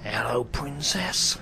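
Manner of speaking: low, very slow greeting in a put-on voice; Dirty Den. Comedian does the requested character for his sidekick, low impression. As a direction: drawl it deep, stretching both words. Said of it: "Dirty Den".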